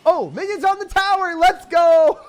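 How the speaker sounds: high, hyped yelling; celebrating the hit; excited shout. Real, not acted. Minions reach the tower.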